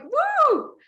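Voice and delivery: high, loud whooping cheer; enthusiastic woo. Shown as a demonstration. Showing listeners the enthusiasm to record, loud whooping cheer. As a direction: let out a big joyful whoop. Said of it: "is to add enthusiasm".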